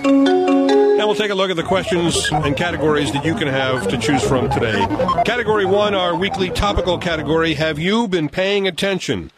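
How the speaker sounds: high, bright quiz-host read; game show announcer. Acted. Reads quiz categories over music.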